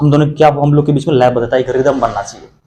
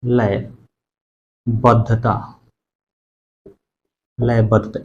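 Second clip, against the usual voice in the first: slow rhythmic repetition; much slower than usual. Shown as a demonstration. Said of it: "और ताल के साथ एक बोलो तो लयबद्धता".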